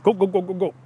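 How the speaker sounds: imitated glugging drink; repeated throaty gulps, evenly spaced; gulping. A narrator's reading. Young man drinks the mole's potion, gulping. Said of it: "Here, drink this".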